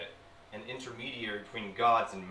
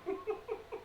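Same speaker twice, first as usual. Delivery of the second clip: short sinister snicker; sly demon chuckle; 6 dB quieter than usual. Acted.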